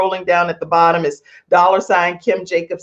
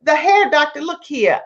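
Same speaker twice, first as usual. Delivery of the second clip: calling out; 8 semitones higher than usual. Real, not acted. Calling out to viewer.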